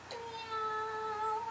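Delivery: high, soft, drawn-out meow; puppy meowing. A narrator's reading. George the puppy meows instead of barking, high and soft. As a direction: mew softly like a kitten.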